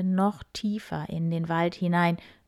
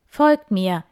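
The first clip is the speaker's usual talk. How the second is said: loud call, louder; 9 dB louder than usual. Acted. Shepherd Leonhard calls the fleeing group, loud. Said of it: "rief Leonhard".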